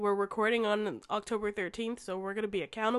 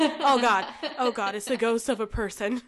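panicked gasping realization; sudden loud mock terror; shaky stammering panic; 3 semitones higher, 8 dB louder than usual. Realizing the ghost is real.

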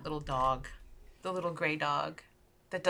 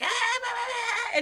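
high cackling bird shriek, like a cartoon woodpecker laugh; screaming blue jay; slower than usual. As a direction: shriek and babble like a fleeing bird. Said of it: "like, screaming. It almost sounds like Woody Woodpecker laughing".

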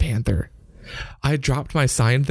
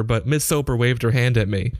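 plain reading voice

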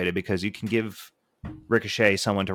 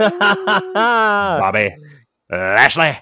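loud, high ring announcer call; 10 semitones higher, 10 dB louder, much slower than usual. Pretend. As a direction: belt the name out like an announcer. Calling out a wrestler entrance.

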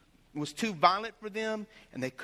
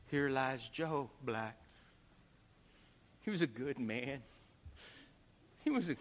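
mock funeral preaching; 8 semitones lower, 6 dB quieter than usual. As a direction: intone it like a graveside preacher. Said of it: "I started preaching".

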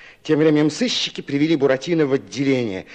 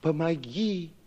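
sighing plea; 8 dB quieter than usual. A narrator's reading. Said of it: "лягушки вдруг все враз вздохнули".

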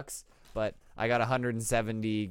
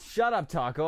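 ordinary talk

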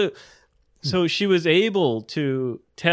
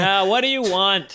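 louder teasing demand; playfully suspicious; mock suspicion; 7 semitones higher, 4 dB louder than usual. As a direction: push them to admit what they're after. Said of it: "I'm suspicious".

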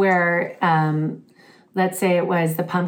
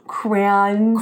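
crayons said the British way, vowels drawn out; fake British accent. Shown as a demonstration. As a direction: say crayons like a Brit trying American. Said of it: "I feel like I'm a British person".

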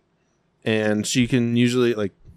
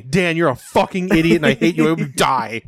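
loud, raised-pitch pretend outrage; mock furious tirade; 5 semitones higher, 4 dB louder than usual. Friends dogpiling on each other, mock furious. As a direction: hurl insults in play anger.

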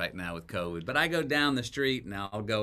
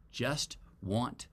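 slow, spaced-out words, softer; retold advice, slowly and simply; measured advice; 4 semitones lower, 6 dB quieter, slower than usual. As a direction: deliver the one rule word by word. Retold advice from talk show host.

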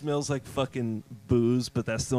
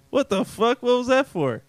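mock outrage; 9 semitones higher, 3 dB louder than usual. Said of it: "the Chinese guy's like".

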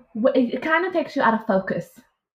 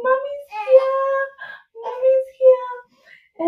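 much higher, slow and tender; tender baby voice; much slower than usual. Retold or imitated. Mother greeting her newborn, tender cooing. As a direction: coo to a newborn.

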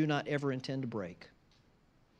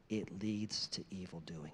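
hushed whisper, much softer; whispered warning; 7 semitones lower, 8 dB quieter than usual. Real, not acted. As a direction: drop to a whisper to land the point. Whispered repetition.

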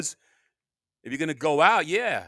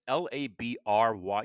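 ordinary talk, nothing marked

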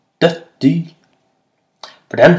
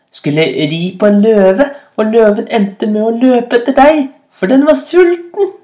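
gleeful taunting; 8 semitones higher, 4 dB louder than usual. A narrator's reading. Rabbit recalling the hungry lion trick, gleefully taunting. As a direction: voice climbing to a very high cry.

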